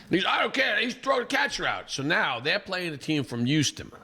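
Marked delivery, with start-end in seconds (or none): 0.3-1.9 high, stubborn mock yell; mock yelling dad. Batter's dad refusing the rule, mock yell. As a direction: dig in like the angry dad. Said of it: "is yelling".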